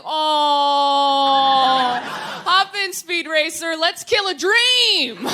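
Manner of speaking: loud drawn-out exclamation into a slowing, rising finish; hammed-up gung-ho yell, stretching the last words; mock enthusiasm. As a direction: belt it out like a fake thrill. Sarcastic reply to car-sex suggestion.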